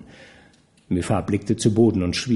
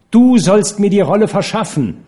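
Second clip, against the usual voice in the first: demanding Nana; 9 semitones higher, 6 dB louder than usual. Acted.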